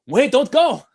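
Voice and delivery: high, loud cartoon vendor impression; Robin Williams as vendor. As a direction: call out like an animated street seller desperate for customers. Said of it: "sound like Robin Williams in Aladdin".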